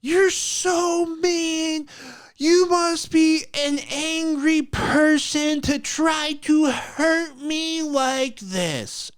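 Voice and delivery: high, slow, whiny mock sob voice; hammed-up crying woman, like too many cigarettes; her voice, crying. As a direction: imitate her tearful complaint, stretched and high. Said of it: "this is what her voice actually sounds like".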